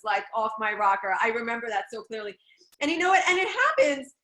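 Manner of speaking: normal talking voice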